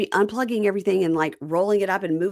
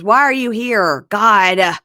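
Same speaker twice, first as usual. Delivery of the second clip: loud, drawn-out mock exasperation; exasperated; 2 semitones higher, 9 dB louder, slower than usual. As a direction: retell it as a raised, fed-up complaint. Acting out his exasperated confrontation.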